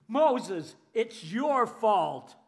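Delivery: loud, slow accusing yell; angry blame. Retold or imitated. Frightened Israelites shouting their blame at Moses. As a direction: pin it all on Moses, shouting. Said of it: "you look for someone to blame".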